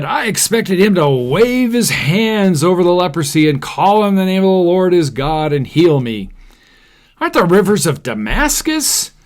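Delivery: the proud commander protests, pitch climbing; indignant rant. Acted. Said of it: "went away in a rage".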